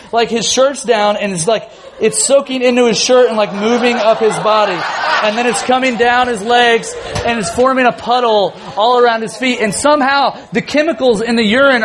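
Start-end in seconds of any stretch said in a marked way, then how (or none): none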